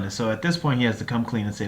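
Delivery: ordinary delivery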